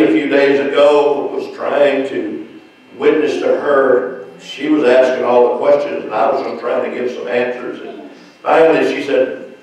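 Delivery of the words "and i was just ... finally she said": normal talking voice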